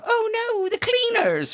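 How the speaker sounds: very high squeaky panicked "Oh no, the cleaners"; quoting the Labyrinth cleaners scene in a high squeak; Labyrinth quote. Pretend. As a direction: squeal it like a frightened little creature. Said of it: "for the Labyrinth reference".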